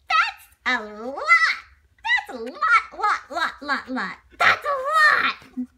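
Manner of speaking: high, loud, drawn-out that's a lot; amazed little bunny. Acted. Little bunny amazed by dad's love, high and loud. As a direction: marvel at how far it is, laughing.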